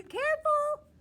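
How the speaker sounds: loud, high call to be careful; sharp warning shout. Real, not acted. Teacher shouting a warning to kicking children. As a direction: stop them before they kick. Warning kids not to kick.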